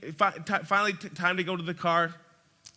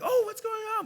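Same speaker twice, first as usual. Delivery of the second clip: much higher, wide-eyed oh; squeaky toddler wonder; curious kids; 13 semitones higher than usual. Retold or imitated. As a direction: kids gawking out the car window. Kids voiced, distracted by window.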